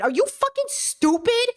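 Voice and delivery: high-pitched incredulous snap; exasperated outburst. Real, not acted. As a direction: fling it out, fed up and disbelieving. Ranting at politicians.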